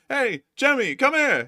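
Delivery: high-pitched call across the room; calling someone over. Acted. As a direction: summon a coworker from afar. Acting out calling a coworker over.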